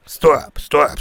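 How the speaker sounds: bellowing to silence the other man; loud shout. Acted. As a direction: shout stop over them loudly. Shouting down caller on prank call.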